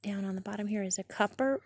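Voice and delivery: ordinary delivery, nothing marked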